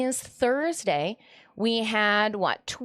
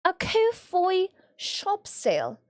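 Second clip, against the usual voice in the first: mock posh lady; 6 semitones higher, slower than usual. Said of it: "you say?".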